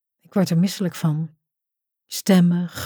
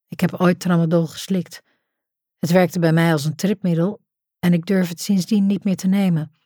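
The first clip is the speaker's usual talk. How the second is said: ordinary delivery, nothing marked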